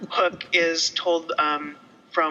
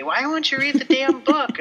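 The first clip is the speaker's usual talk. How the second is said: higher, impatient nagging; mock nagging friends; 9 semitones higher, 4 dB louder than usual. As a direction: pester her like the group does. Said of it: "they're all bitching at me".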